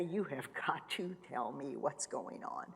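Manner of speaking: a whispered cry to God; desperate whisper. Retold or imitated. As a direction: beg in a broken whisper. Crying out to God.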